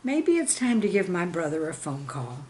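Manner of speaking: plain reading voice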